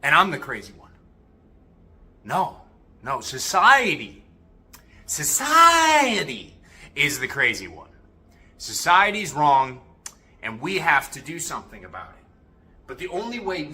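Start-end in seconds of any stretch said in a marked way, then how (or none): 2.2-9.8 loud, slow, drawn-out insistence; emphatic indignation. Real, not acted. Throwing the charge of crazy back at society, loudly indignant. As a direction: stretch out "society" and hit it hard. Rebutting being called crazy.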